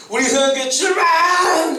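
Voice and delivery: high, loud, drawn-out slow cry of 출발; rallying cry. Shown as a demonstration. Pastor leading his church out like a trumpet, slow loud rallying cry. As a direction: call the whole church to march out, slowly and loud. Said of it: "이렇게 나가야지".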